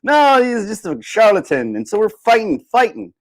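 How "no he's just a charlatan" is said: higher, slow, scornful dismissal; mock sceptic. Crowd member arguing the magician is a fake, higher and slow. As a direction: play a sneering doubter, drawn out and higher. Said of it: "another person is arguing that".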